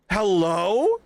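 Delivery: a bigger, incredulous Hello?. Pretend. Louder repeat of hello.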